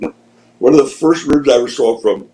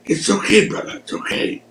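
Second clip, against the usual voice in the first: soothing wrestler; 10 semitones higher than usual. Retold or imitated. Jimmy voiced in wrestling story.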